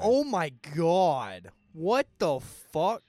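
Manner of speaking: loud, slow, drawn-out disbelief; real shock. Real, not acted. Podcast host reacting to a horror film's opening, shocked. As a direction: let each shocked word land hard and slow.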